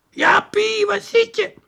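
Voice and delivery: very high, strained calling; desperate father. Acted. Father calling his lost son, very high. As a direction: call out high in despair.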